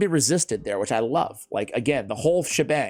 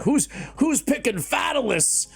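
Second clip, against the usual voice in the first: put-on New England accent; 8 semitones higher, slower than usual. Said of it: "I say it with, like, a New England accent".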